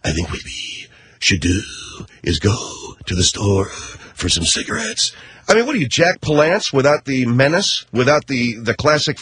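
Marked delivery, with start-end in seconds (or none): 0.0-5.1 low, soft wheezy mock old-man voice; a mocking impression of a tired old actor, lower and softer; wheezing old Eastwood. Radio host mocking Clint Eastwood's wheezing, a low soft old-man impression. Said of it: "You're wheezing now. You're going".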